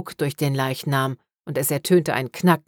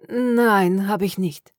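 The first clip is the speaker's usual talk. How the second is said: higher, tight, strained no, barely breathing; pressed no; 6 semitones higher, slower than usual. A narrator's reading. Pale detective answers the necromancer, pressed and higher. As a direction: force out the word holding your breath. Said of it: "sagte Darla gepresst".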